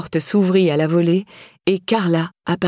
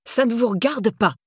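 higher-pitched angry rebuff, no real volume; mild thundering; 5 semitones higher than usual. A narrator's reading. Carla rebuffs prying questions, mildly angry. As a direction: shut her down with restrained anger. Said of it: "tonna Carla".

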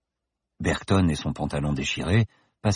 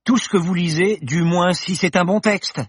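mocking young woman; 12 semitones higher, 4 dB louder than usual. Paloma mocking.

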